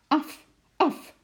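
high, loud panicked call at the dog; panicked cry. A narrator's reading. Lotje calls the dog off Sjimp in panic. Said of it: "riep Lotje in paniek".

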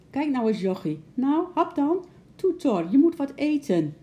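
high, loud coaxing; pleading. A narrator's reading. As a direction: urge the sick animal to eat, almost begging. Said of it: "Haast smekend keek Bart".

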